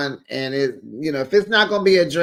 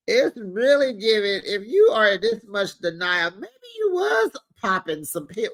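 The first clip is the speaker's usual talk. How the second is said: laughing disbelief; 5 semitones higher than usual. Real, not acted.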